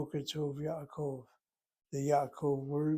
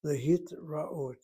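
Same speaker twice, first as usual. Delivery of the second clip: slow careful pronunciation; slower than usual. Shown as a demonstration. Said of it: "We'll put that word in the board".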